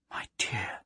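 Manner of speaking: soft whispered endearment. Acted. Plaxi whispering to calm the growling dog. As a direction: breathe it to calm the beast.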